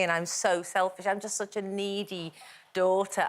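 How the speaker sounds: ordinary talk, nothing marked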